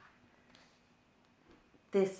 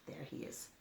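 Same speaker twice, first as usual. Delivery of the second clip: whispered; 3 semitones lower, 15 dB quieter, faster than usual. Pretend. Pointing out hidden creature to kids.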